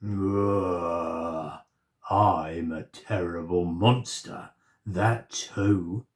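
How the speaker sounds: low growling monster. A narrator's reading. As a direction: boast slowly and darkly. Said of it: "said the voice".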